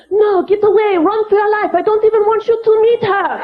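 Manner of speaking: put-on urgent French-accented warning to friends; embarrassed French kids. Embarrassed kids warn their friends off their mother, in frantic mock French accents. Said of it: "people that have French accents".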